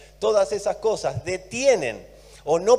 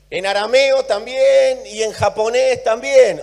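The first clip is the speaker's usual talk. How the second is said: higher and louder ironic jab; sarcastic aside; 6 semitones higher, 7 dB louder than usual. Real, not acted. Mocking over-spiritualizing preachers, sarcastic. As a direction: toss it off with irony.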